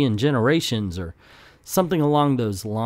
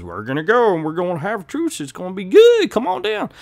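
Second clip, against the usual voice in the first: put-on deep Southern accent; 5 semitones higher than usual. Falcon rallying the gangs to a truce, deep Southern accent. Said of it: "talk with a deep Southern accent like that".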